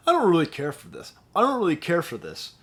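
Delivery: dismissive verdict. Acted. Reviewer telling you his pop verdict, dismissive. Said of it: "I'm gonna tell you".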